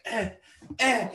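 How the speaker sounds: high loud "Eh" grunts like firing; mock gunshot grunts. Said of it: "them going like".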